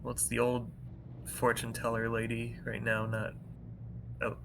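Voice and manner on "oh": ordinary talk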